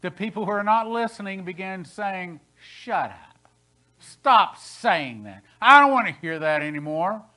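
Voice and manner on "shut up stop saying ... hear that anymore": loud, slow, hostile shout of rejection; aggressive retort. Retold or imitated. The wolves shouting at the preacher, loud and aggressive. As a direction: hostile churchgoers telling the preacher to stop, loud and angry. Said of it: "They were aggressively saying".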